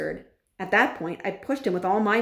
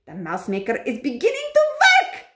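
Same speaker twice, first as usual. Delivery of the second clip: raised witch voice climbing to a yell; screaming witch; 7 semitones higher, 6 dB louder than usual. A narrator's reading. Grand High Witch seeing her potion work, screaming. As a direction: build to a high, triumphant shout. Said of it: "screamed the Grand High Witch".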